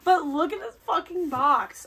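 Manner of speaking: gushing over the gift box; excited. Real, not acted. Vlogger unboxing fan's gift, thrilled exclamation.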